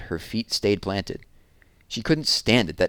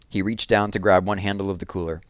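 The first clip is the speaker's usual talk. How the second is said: plain reading voice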